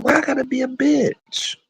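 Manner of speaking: high hurt voice. Retold or imitated. Retelling the fight, asking the friend why. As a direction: whine it, wounded. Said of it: "I said".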